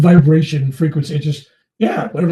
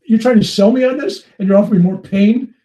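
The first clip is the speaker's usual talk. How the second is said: indignant protest; 7 semitones higher, 8 dB louder than usual. Retold or imitated. Arguing with the guru.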